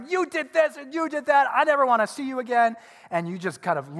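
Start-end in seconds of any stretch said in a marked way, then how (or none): 0.1-2.8 high, loud mock tirade; high-pitched, acted angry yelling; acted screaming. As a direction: hurl accusations as if screaming at family. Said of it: "And you're just screaming".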